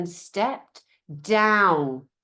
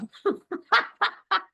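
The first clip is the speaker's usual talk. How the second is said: real amused laugh, high; 12 semitones higher than usual. Real, not acted. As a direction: laugh at herself. Laughing at herself.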